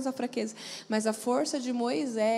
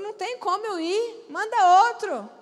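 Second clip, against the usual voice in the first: Moses pleading; 6 semitones higher, 7 dB louder than usual. Retold or imitated.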